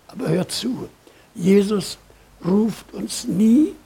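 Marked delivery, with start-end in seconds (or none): 2.4-3.7 "nie" held long and rising, whole phrase slow; preacher insisting to the congregation, slow and emphatic; stress on nie. Shown as a demonstration. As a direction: lean hard on "nie", dragging the words out. Preacher drives home the point.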